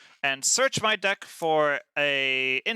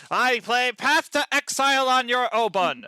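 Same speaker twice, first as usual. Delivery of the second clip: higher, louder and slow; triumphant; 8 semitones higher, 5 dB louder than usual. Real, not acted. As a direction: announce it with glee. Triumphant card play.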